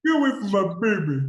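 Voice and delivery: joining in the mock protest, high and loud; quick hammed-up caricature of an angry father; Eric voice. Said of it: "That was Eric's voice".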